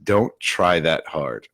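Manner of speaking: ordinary delivery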